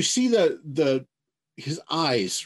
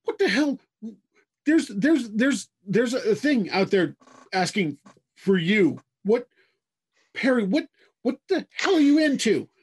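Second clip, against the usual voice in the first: baffled mother, pitched up; flummoxed woman; 6 semitones higher than usual. Acted. Woman finds a strange thing asking for her son, flummoxed. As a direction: sputter it in high, stumbling disbelief. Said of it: "just flummoxed woman".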